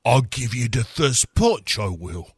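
put-on cockney girl taunt; mock cockney. Spice Girls mocking villain.

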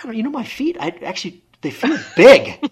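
mock-alarmed about my feet; worried complaint. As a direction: whine about swollen feet, laughing. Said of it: "I'm telling him".

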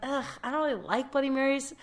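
mild mock reluctance; reluctant groan. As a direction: turn it down with a groan. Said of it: "I was like".

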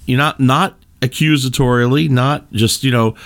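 ordinary talk, nothing marked

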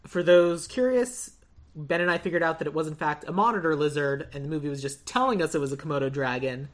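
normal talking voice

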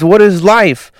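high-pitched acted existential panic; mock freak-out. Manny demanding answers from Hank, freaking out. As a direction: cry it out as an overwhelmed character. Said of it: "freaking out".

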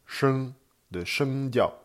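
slow, high SHENG and SHENGDIAO with Chinese tones; teacher drawing out the tones of "shengdiao"; Mandarin word demo. Shown as a demonstration. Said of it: "Alors, autre exemple".

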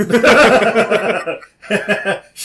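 high, loud burst of laughing; loud laughter. Real, not acted. Laughing at spit joke.

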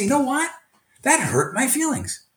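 high-pitched, wounded appeal; acted-out sincere protest, pitched high; earnest hurt complaint. Shown as a demonstration. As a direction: model a teacher owning hurt feelings. Models telling students of hurt feelings.

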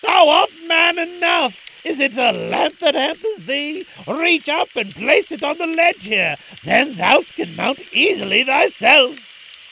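high, loud dervish urging from above; calling down. Acted. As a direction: project commands down to the boy below. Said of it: "he called down".

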